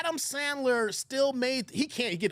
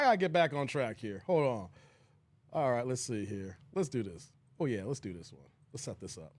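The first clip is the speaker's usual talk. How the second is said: muttering to himself; 11 semitones lower, 5 dB quieter than usual. Real, not acted. Muttering while setting up video.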